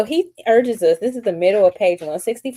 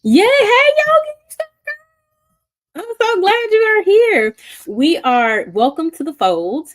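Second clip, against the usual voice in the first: much higher and louder, sing-song welcome; excited greeting; 7 dB louder than usual. Real, not acted. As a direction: throw open the doors for new guests. Greeting newcomers on stream.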